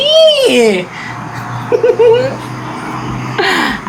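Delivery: long triumphant cackle; gloating laugh. Acted. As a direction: laugh over a successful trick. Gloats after victim passes out. (mahabang halakhak ng tagumpay)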